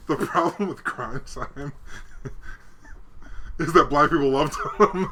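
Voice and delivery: laughing through the words; giggling while talking. Real, not acted.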